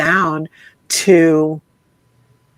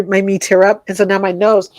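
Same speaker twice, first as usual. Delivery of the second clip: ordinary talk, nothing marked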